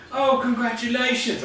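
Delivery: high-pitched New Zealand caricature; New Zealand accent. Acted. Tournament host congratulating the winners, put-on New Zealand accent. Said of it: "doing my best New Zealand accent".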